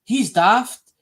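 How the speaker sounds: loud scornful laugh at the seller; jeering ha ha ha; mocking laughter. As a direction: laugh him off as daft. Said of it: "And they'll start laughing".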